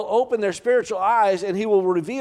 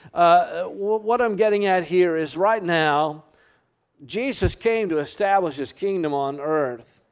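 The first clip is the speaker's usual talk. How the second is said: ordinary talk, nothing marked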